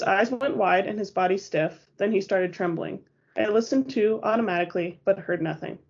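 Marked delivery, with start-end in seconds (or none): none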